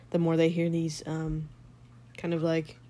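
usual speaking voice

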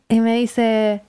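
ordinary talk, nothing marked

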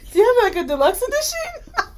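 high-pitched incredulous giggling; laughing. Real, not acted. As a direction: ask it through rising laughter. Joking while both laugh.

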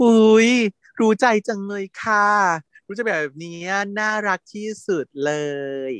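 high, gushing mother voice; proud mother. Retold or imitated. Mother boasting to father on the balcony, high and gushing. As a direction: coo over your favourite child.

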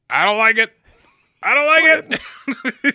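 high, loud mock whine; whiny high voice, pushed loud; imitating Mark Norman. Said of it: "He's just like".

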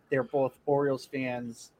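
usual speaking voice

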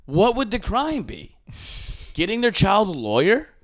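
high, disbelieving retort; incredulous. Retold or imitated. Podcaster retelling his reply to the mob, incredulous and high. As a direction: act baffled by the demand.

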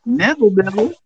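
high-pitched, rising announcement of a name; speaking up. Real, not acted. Introducing the guest on request, speaking up. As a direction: pitch it up so everybody can hear. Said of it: "Say it louder so everybody can hear you".

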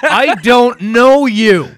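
high, loud and slow, through laughter; laughing mock retort. Mocking the hot girl in line with a laughing retort.

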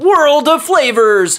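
high, slow mock announcer call. Second bag's brand name, high drawn-out mock announcer. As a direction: draw it out high and grandly.

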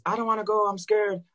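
high mock-scared whine; wife saying she's scared, mock frightened. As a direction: imitate the wife's nervous plea. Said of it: "I told Liz".

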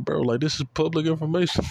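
high, exasperated stress on information. Real, not acted. To fans quizzing his knowledge, an exasperated high retort. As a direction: throw up your hands at it.

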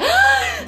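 sharp high gasp of delight; excited gasp. Pretend. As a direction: gasp like great news just came. Mock joy at being summer people.

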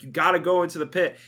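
loud urgent. Retold or imitated. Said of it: "and it's like".

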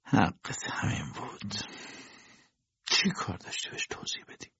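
soft, whispered aside under the breath; whispered self-reproach; muttered to himself. A narrator's reading. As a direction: mutter it to yourself, not for her to hear. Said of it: "با لحنی که برای خود حرف بزند زیر لب گفت".

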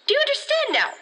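high, strained demand; angry yelling. Acted. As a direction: force it into her head, near shouting. Outburst directed at Jenny.

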